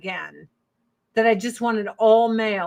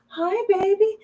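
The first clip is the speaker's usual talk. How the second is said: high, cooing pet voice; 10 semitones higher than usual. Real, not acted. Cooing hello to her cat. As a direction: coo at the cat.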